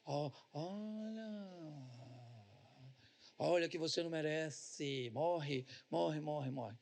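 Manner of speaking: slow, low, soft tempter voice; preacher voicing Satan to the congregation, soft and low; Satan whispering. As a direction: hiss it like the devil in your ear. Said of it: "Aí o Satanás vem em cima de você e diz".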